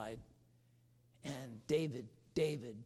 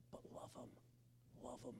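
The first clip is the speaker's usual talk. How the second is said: whispered plea; 10 semitones higher, 18 dB quieter, faster than usual. Acted.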